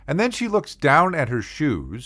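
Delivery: normal talking voice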